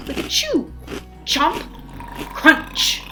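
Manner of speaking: ordinary delivery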